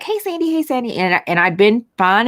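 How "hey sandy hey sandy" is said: high sing-song hello from the crowd; cheery greeting. Retold or imitated. Coworkers in the lobby greeting Sandy, high and cheery. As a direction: greet her brightly like coworkers do. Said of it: "everybody's like".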